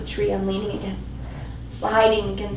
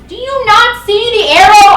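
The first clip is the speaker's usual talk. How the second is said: much higher and louder, venomous; spat in fury; 12 semitones higher, 13 dB louder than usual. Acted. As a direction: spit the words at him. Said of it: "I spit at him".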